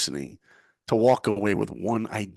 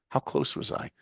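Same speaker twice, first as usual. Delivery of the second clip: quiet question; 8 semitones lower, 5 dB quieter than usual. Retold or imitated. Asking the rejecter quietly.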